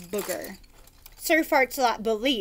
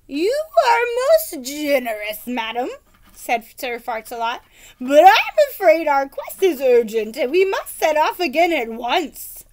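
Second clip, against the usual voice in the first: gallant knight; 3 semitones higher, 6 dB louder than usual. Acted. Knight declines politely.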